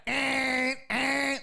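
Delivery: high, mock angry eh eh; mock honking yell. Trucker honking at the constable, hammed-up high yell. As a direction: ham up the trucker's angry honk noise. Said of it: "he's screaming".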